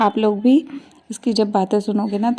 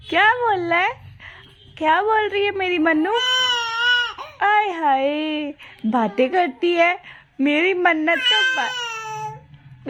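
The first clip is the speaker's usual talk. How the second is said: higher, drawn-out sing-song cooing; 9 semitones higher than usual. Real, not acted. Mother marvelling that her little girl talks, cooing baby-talk.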